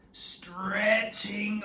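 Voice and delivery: drawn-out, slow "stretching over the sky"; stretched out slowly. Shown as a demonstration. Teacher showing children the meaning of stretching, slow and drawn out. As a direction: stretch the words like a rainbow across the sky. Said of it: "spells stretching here, to really show the meaning".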